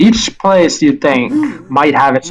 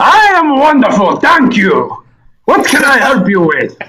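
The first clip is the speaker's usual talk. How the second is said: high, hearty Russian creature voice; heavy Russian accent; 11 semitones higher than usual. Acted. Tower creature welcoming Sal, heavy Russian accent. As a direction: greet them in thick Russian-accented English. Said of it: "So a deep Russian voice".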